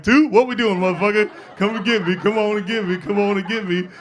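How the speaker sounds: high, loud mock dare; defiant challenge. Teen challenging his father's counting, defiant. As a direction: dare him to come over, repeating it insistently.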